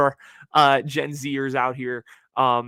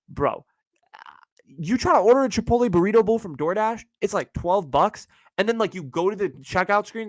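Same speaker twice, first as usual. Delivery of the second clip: normal talking voice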